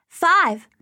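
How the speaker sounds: put-on high, loud little girl cry; excited child. A narrator's reading. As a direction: shout it out like a proud five-year-old. Child shouts her age.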